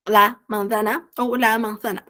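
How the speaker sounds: first manzana with a plain S sound, second manzana with the tongue between the teeth; teacher contrasting S and TH in manzana; two pronunciations of manzana. Shown as a demonstration. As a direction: say manzana once with S, then once with a lisped TH. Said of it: "Pode ter esse som de Z normal".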